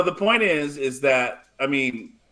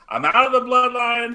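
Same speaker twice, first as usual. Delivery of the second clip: higher, raised-voice wrestler declaration; Jimmy; 7 semitones higher, 4 dB louder than usual. Retold or imitated. Podcaster retells wrestler quitting to the camera, mock defiant declaration. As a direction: imitate a wrestler announcing it to the camera. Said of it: "Jimmy turns to the camera and says".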